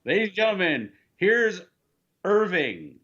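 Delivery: high, measured emcee call; mock lounge announcer. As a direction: introduce a lounge act to the casino crowd. Voicing a casino lounge act introduction.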